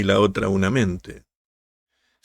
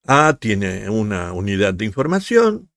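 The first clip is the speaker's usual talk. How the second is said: ordinary delivery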